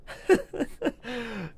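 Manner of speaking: soft, high giggle; high chuckle. Real, not acted. Streamer amused by the chat, softly giggling. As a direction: let out a light, high laugh. Laughs at the surprise.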